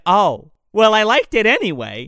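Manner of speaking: hammed-up awkward shrug of a line; mock sheepish reply. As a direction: brush it off, loud and cheeky. Retelling a movie scene, acting quotes.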